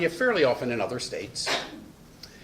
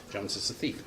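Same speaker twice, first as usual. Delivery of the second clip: low, soft, offhand accusation; quiet aside; 6 semitones lower, 10 dB quieter than usual. Shown as a demonstration. Lecturer telling a student about Jones, quiet aside. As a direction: drop it low as a quick aside. Intentional remark repeated as aside.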